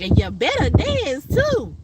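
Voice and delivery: high, slow bossy tease; slow teasing elder. Retold or imitated. Friend insisting she dance at the funeral, slow high tease. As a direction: drag out the playful order.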